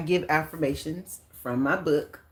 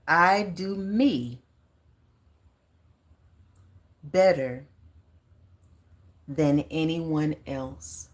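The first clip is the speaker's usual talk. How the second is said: slow, spaced affirmation with long pauses; much slower than usual. Shown as a demonstration. Leading viewers in an affirmation, slow and deliberate. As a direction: set out each word for them to echo. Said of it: "So repeat that, please".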